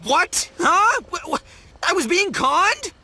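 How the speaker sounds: high, stammering astonishment; shocked disbelief. Acted. Duped man just learning he was conned, shocked disbelief. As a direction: blurt it out high, struggling to take it in.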